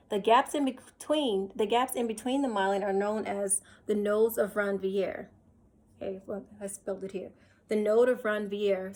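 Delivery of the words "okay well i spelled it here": ordinary talk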